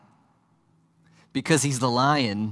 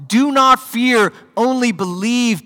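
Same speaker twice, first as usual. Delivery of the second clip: much higher and louder, slow "Do not fear, only believe"; triumphant proclamation; 11 semitones higher, 8 dB louder, slower than usual. Shown as a demonstration. Jesus proclaiming lordship over death, slow, high and loud. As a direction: proclaim it like the Lord of life. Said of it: "His lordship over death itself".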